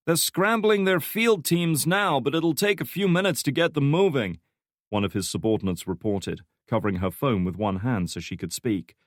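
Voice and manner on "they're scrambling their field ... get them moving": female analyst reporting. A narrator's reading. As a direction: report while covering the phone. Subordinate reporting on phone.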